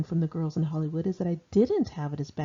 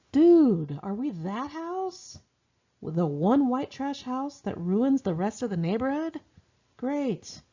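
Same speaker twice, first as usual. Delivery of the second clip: pitched-up mock dismay at the house; sarcastic disbelief; 6 semitones higher, slower than usual. Daughter seeing the new house, sarcastic dismay. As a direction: sneer at the run-down house, eyes rolling.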